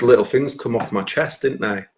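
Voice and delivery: ordinary talk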